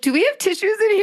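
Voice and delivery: half-laughing, half-crying call for tissues; teary plea for tissues. Real, not acted. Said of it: "I just got really emotional".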